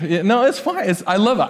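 normal talking voice

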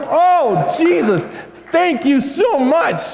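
high, slow, overwhelmed thanks; grateful cry to Jesus. Pretend. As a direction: let the thanks pour out slowly. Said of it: "It should give us like".

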